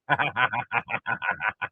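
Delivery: low, chuckling run of laughter. Real, not acted. Laughing at the player who went flying, low chuckling laugh. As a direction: crack up in a quick string of chuckles. Laughing at his own mimicry.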